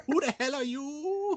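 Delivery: very high silly mock voice; squeaky parody voice. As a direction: squeak it out as a joke. Parodying the Merlin clip.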